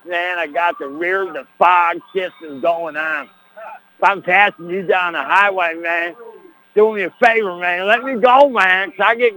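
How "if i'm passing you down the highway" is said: normal talking voice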